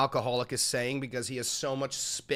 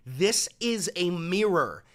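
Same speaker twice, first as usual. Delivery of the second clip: normal talking voice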